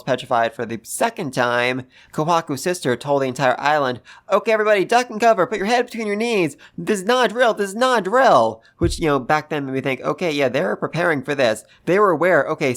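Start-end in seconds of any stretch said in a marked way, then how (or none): 4.3-8.6 higher-pitched imitated safety warning; acted emergency announcement. Sister told the entire island to take cover, acted warning. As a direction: lightly act out urgent orders to a crowd.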